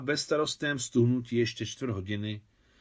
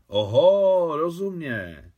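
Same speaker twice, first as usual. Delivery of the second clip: high, louder teasing "Oho, rozumně"; mock echo; 6 semitones higher, 7 dB louder, much slower than usual. Wife teasing Pavel, repeating his caution mockingly. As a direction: tease him by echoing his word. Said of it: "ale rozumně".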